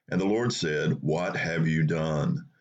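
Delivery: ordinary talk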